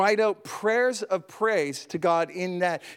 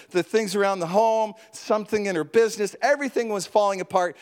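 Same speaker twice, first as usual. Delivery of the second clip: normal talking voice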